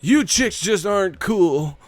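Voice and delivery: high, slow dorky guy; goofy square. Retold or imitated. Still mocking the squares in Bob's high voice. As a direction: talk like an uncool party guy.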